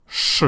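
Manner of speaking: sh on its own, voiceless; isolated sh sound. Shown as a demonstration. Teacher showing the rule for the last letter, isolated sh sound. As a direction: give a lone "sh", the sound of a word-final Zh. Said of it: "it is pronounced like this".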